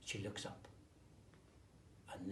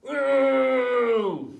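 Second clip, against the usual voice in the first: very loud, much higher mimicking shout; monkeys copying; 13 semitones higher, 20 dB louder than usual. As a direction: screech it back like cheeky monkeys. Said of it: "They do".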